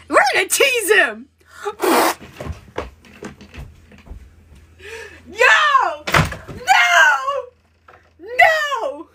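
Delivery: high, loud, laughing squeals; flustered squealing. Real, not acted. A fan losing it over the killer's flirting. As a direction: shriek with giddy overload. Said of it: "I'm too flustered".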